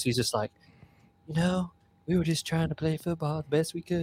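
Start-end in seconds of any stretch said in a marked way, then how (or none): 1.3-4.0 flat, droning Tim Tebow monotone. Retold or imitated. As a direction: drone every word evenly, mocking Tebow. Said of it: "his weird monotone voice".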